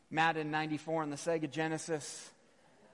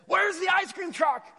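comically frantic yell, pitched way up; frantic mock shout; 9 dB louder than usual. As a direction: blurt it out like a desperate ice-cream-hunting kid. Frantic kid, jokingly not calm.

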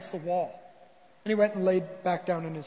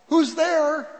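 higher, louder call; raised voice, demanding who is there; 7 semitones higher, 7 dB louder than usual. Acted. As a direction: call out through a closed door. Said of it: "Prince Albert called out".

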